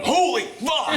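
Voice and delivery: sudden high outburst of shock; shocked exclamation. Real, not acted. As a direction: blurt it out in shock. Shock at the film scene.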